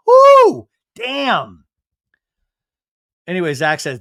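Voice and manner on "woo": loud, very high "woo"; excited whoop. Real, not acted. Reacting to Zach's retort, excited whoop. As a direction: let out a big high whoop.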